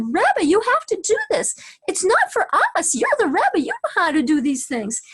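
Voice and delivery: high, pleading, a touch tearful; a woman pleading with the Rebbe, mock tearful; imitating a crying woman. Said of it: "one woman was, like, crying".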